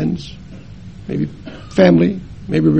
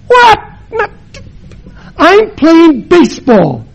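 put-on startled boy; 11 semitones higher, 7 dB louder, slower than usual.

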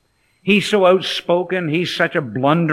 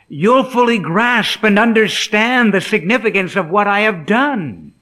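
high, loud preaching as Jesus to Peter; emphatic proclamation; 6 semitones higher, 6 dB louder than usual. Acted. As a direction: declare the promise with raised, ringing emphasis. Preacher voices Jesus explaining to Peter.

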